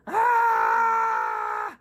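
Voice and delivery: high piercing worm shriek. Acted. Worm shrieks escaping the bird, high scream. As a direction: let out a tiny terrified scream.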